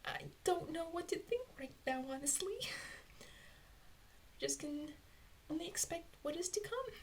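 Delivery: high, softened voice for a stunned character; Pinkie Pie. Acted. Fan imagining a pony facing what is coming, high-voiced disbelief. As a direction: voice a character's disbelief, pitched well up. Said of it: "Actually, Pinkie looks like".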